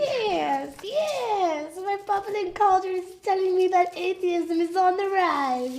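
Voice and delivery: high, loud cackling witch; evil witch voice. Acted. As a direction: play a gloating evil witch. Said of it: "Now I have to do an evil witch voice".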